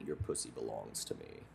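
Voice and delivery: deep gruff murmur; low growl. Retold or imitated. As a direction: rumble it low and menacing.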